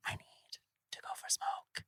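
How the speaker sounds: barely audible desperate whisper; whispered plea. Retold or imitated. Retelling plea to the team for a break, whispered. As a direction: whisper it as an urgent, strained plea.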